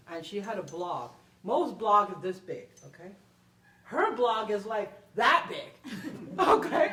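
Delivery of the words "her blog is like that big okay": laughing through it, louder; amused, marvelling at the size. Real, not acted. Telling the interviewer about the huge blog, laughing.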